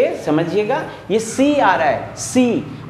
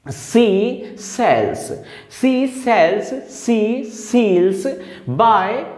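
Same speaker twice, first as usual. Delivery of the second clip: slow, drawn-out reading of the example; much slower than usual. Shown as a demonstration. Teacher reading the example slowly to show the s sound. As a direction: stretch each s sound for the class. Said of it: "अब यहाँ पर जो स".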